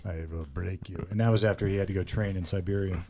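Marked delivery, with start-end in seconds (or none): 0.0-1.1 low, slow, quiet Russian boxer threat; Drago voice. Retold or imitated. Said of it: "He took on Drago".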